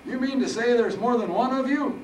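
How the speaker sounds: put-on high, loud, baffled old man; confused. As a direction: blurt it out in Harold's puzzled squeak. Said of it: "Harold was slightly confused".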